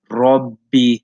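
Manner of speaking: "Rabbi" with the ba held long, as if said twice; doubled ba. Shown as a demonstration. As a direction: hold the b in "Rabbi". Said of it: "as if you're saying two bas".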